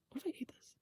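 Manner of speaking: worried whispered aside to herself. Real, not acted. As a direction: mutter the doubt under your breath. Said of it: "aside to herself".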